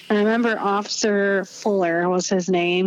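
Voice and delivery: usual speaking voice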